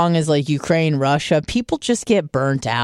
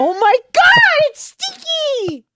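very high, slow, squealing disgust; slower than usual. Retold or imitated. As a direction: squeal it in horror. Retold disgust at smell.

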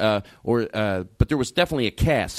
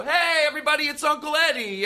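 much higher, bright announcer call; a cheery emcee call, well above his usual voice; host announcing; 14 semitones higher than usual. Retold or imitated. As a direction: hype up the room like a show host. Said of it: "And then they go".

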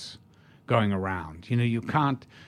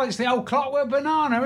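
much higher Cockney "Clockwork Banana", stretched and hammed; Cockney fan; 13 semitones higher than usual. Cockney fan mangling the film title, high hammed Cockney. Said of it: "this Cockney comes in".

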